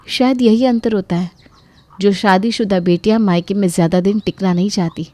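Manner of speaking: ordinary delivery, nothing marked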